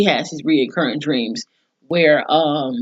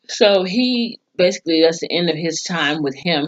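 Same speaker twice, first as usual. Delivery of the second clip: usual speaking voice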